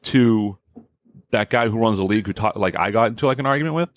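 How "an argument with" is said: normal talking voice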